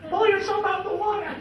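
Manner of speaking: high, loud laughing curse at the deer; cussing. Retold or imitated. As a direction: yell it at the deer through laughter. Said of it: "Grandpa's cussing at it".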